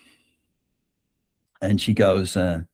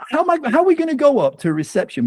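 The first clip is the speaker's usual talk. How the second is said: high, fast flustered objection; tumbling over the words in alarm; retold panic about facing the hotel reception; flustered protest; 13 semitones higher, faster than usual.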